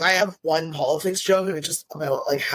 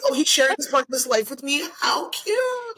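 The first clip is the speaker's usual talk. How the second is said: high-pitched cooing, climbing higher at the end; mock gushing; 9 semitones higher than usual. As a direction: coo as if totally charmed, sarcastically. Mocking her own romantic thinking.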